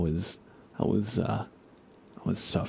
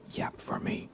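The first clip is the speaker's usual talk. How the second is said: a hushed plea for the pet to talk; whispered coaxing; 3 dB quieter than usual. Real, not acted. Streamer coaxes pet to talk.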